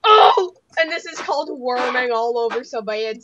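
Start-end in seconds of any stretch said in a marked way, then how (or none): 0.0-0.5 sudden high startled shout; startled yell. Real, not acted. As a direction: yelp in surprise. Startled in a duel.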